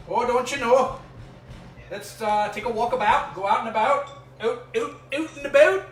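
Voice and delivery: high, loud hammed-up Canadian accent; mock Canadian accent. Host trying to speak Canadian for viewers, hammed-up Canadian accent. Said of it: "should we start speaking Canadian".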